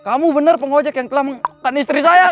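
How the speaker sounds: accusing husband. Retold or imitated. Husband accuses the ojek driver to his face, mock angry. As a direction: pin the blame on him.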